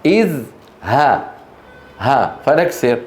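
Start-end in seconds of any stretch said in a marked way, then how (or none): 0.1-1.2 إذ ها split as "iz... ha", starting on i; sounded out by syllable. Shown as a demonstration. Teacher showing the third letter's vowel, syllable by syllable. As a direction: break إذ ها apart with a pause between. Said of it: "إي، بدأنا فيها مكسورة".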